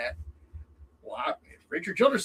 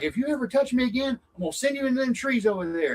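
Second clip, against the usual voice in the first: much higher, hammed-up menace; mock angry threat; 10 semitones higher than usual. Driver threatening to send the kid into the trees, mock angry.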